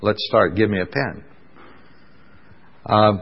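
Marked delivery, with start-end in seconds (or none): 0.0-1.2 lightly voiced eager Bill. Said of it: "And Bill said".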